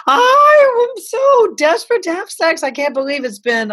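high, breathless desperate friend; lightly voiced desperate girlfriend; girlfriend's voice. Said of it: "a girlfriend of mine called me and said".

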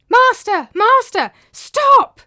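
screaming. A narrator's reading. Tranio running to stop his master, loud high screams. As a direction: scream it while running down the street. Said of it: "screamed Tranio as he ran".

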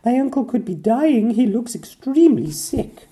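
high, loud, urgent princess; urgent Pearl. A narrator's reading. Pearl urging the others to hurry, anxious. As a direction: hurry them along as Pearl. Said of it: "She told the others".

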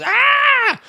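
very high, loud mock shriek; full-pitched hyperactive child screech; imitated kid's scream. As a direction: scream like a kid tearing across the room. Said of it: "screams at the top of his lungs".

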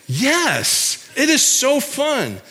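high, delighted, drawn-out retold reply; beaming, high-pitched delight; gleeful yes. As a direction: gush it like a kid who loves his job. Gleefully retelling his own answer.

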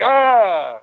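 sudden high yelp of surprise; startled yelp. Retold or imitated. Startled man nearly hit by cart: high yelp.

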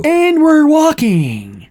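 high, drawn-out comic line; hammed-up, slow movie quote; mock trailer punchline. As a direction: stretch it out high like a corny gag. Mocking scene as trailer gag.

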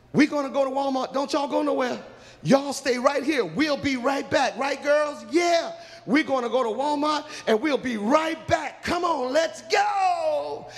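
high-pitched, eager group send-off; acted excited announcement; excited calling out. Girls call out leaving for Walmart.

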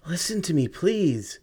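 high, desperate begging; pleading. Acted. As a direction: plead urgently after him. Begging him to stop.

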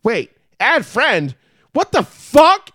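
high, loud mimicry of an offended relative; retold outraged disbelief. Host voices wife's aunt finding herself unfriended, mock outrage.

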